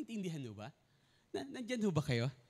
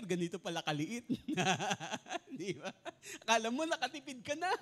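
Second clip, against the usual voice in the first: laughing; 3 dB louder than usual. Real, not acted.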